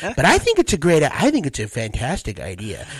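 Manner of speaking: smugless Trump voice rising to high whine; Tandy. Shown as a demonstration. Comic morphing Trump into sitcom character, rising high. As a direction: push the pitch up into excitable, loud protest. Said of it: "bring it up a little bit".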